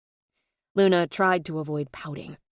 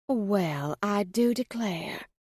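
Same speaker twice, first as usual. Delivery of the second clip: put-on slow, lilting Southern belle accent; Southern drawl; much slower than usual. Luna teasing the marshal in a slow Southern drawl. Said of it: "in her most convincing Southern drawl".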